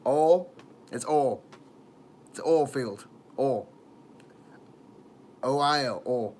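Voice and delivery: oil drawn into "All", southern accent. Shown as a demonstration. Streamer showing how to say oil, southern accent. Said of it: "a southern accent".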